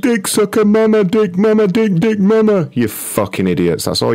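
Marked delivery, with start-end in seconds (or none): 0.0-2.7 high-pitched mock-child chanting; childish mocking. Prankster mimicking the callers' repeated insult, childish mocking. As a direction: parrot their insult back in a silly high voice. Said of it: "You sound like children".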